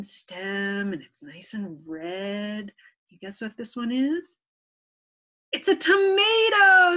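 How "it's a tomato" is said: higher and louder, big cheerful announce; excited reveal. Acted. Teacher revealing the answer to children, excited and loud. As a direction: burst out with the answer.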